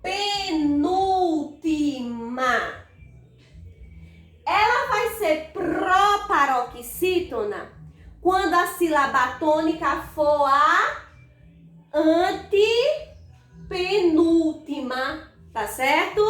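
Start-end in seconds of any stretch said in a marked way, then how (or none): 0.0-2.8 "penúltima" very slowly stretched; syllable by syllable. Shown as a demonstration. Teacher stresses the position. 11.9-15.3 "antepenúltima" slow, split after "ante"; drawn-out key term. Shown as a demonstration. Teacher stresses the position.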